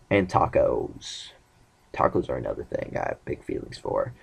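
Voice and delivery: softly whispered afterthought; whispered aside. Real, not acted. Confiding his taco love in a whispered aside.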